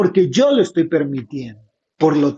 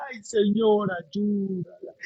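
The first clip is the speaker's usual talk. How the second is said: mock tearful plea; 10 dB quieter, slower than usual. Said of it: "voy a estar llorando".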